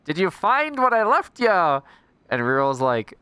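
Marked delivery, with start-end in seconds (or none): 0.0-1.8 mock villain voice. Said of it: "so I was like".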